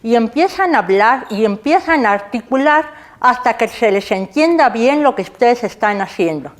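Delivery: talking around a pencil held between the teeth; muffled demo speech with a pencil in the mouth. Shown as a demonstration. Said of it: "Lo voy a hacer sin miedo al ridículo".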